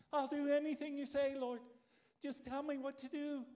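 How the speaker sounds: stranded man begs God to save him, desperate; pleading Jack. Acted. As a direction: bargain for your life.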